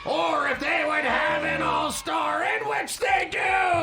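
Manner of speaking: hyped announcer. Acted. Game announcer hype.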